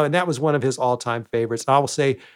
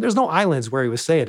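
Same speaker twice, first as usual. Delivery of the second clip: ordinary delivery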